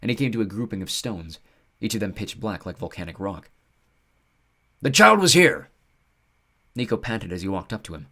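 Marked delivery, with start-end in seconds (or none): 4.8-5.7 high loud eager creature; thrilled, catching the scent; excited centaur. Acted. Centaur finds trace.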